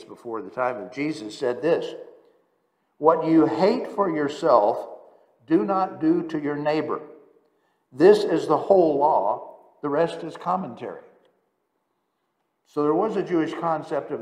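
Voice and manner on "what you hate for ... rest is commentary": solemn quotation. Acted. Quoting Rabbi Hillel.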